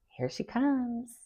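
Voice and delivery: soft, high, slow drawn-out tease; mock announcing taunt. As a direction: stretch it out quietly, imitating a taunt. Mimics a sing-song taunt at reunion.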